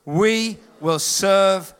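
slow, drawn-out congregational chant; slow unison declaration. Shown as a demonstration. Preacher leads the church in declaring the verse, slowly chanted. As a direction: declare it together, each word stretched. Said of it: "We're gonna declare the family business together".

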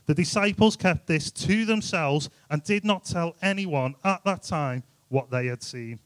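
normal talking voice

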